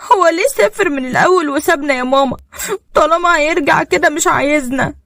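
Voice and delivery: put-on high-pitched crying little boy voice; tearful child. A narrator's reading. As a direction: plead to mama like a hurt, weepy child. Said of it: "وهو بيعيط".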